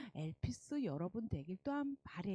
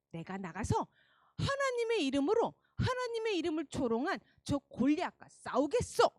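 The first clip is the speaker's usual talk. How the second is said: determined; 6 semitones higher, 5 dB louder than usual. Acted. Boy David resolves to fight.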